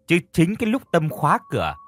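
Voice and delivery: ordinary delivery, nothing marked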